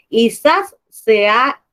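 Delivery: zas alto y lento, marcado; zas con gracia. Shown as a demonstration. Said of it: "ese zas es el sonido que nosotros hacemos para contar". (zas alto y lento, con gracia)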